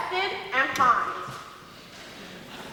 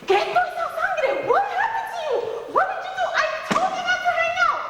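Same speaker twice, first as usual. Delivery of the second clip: much higher, frantic scolding mother; panicked mom yelling about the blood. Retold or imitated. Mother sees blood on her daughter, high frantic scolding. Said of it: "of course you find".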